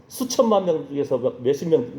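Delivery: usual speaking voice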